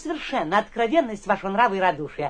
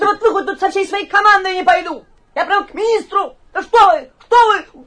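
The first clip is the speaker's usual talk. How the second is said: furious threats; 8 semitones higher, 9 dB louder than usual. Acted. Guest threatens to go to the minister.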